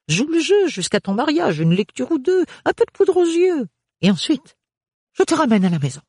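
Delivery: loud, high fussy mother; bossy matron pushing her daughter; overbearing mother. Acted. Mother voiced again.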